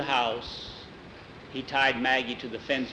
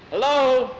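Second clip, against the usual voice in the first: high, loud hello; calling out; 11 semitones higher, 7 dB louder than usual. Acted. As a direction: call hello to someone in the distance. Jackie calls out to man.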